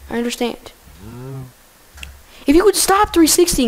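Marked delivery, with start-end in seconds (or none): 2.5-3.7 higher, louder exasperated yell. Real, not acted. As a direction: shout at the spinning cow. Yelling at spinning cow.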